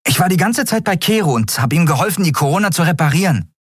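put-on very high, bright boyish voice, delighted; eager boy Miko. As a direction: pitch way up like an excited young lad. Miko proudly reports, fast. (sehr hohe, freudige Jungenstimme)

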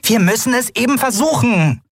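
high little voice, insistent; small figure masking despair; underlying desperation. A narrator's reading. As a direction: urge it in a tiny high voice. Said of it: "die Verzweiflung aus ihren Worten".

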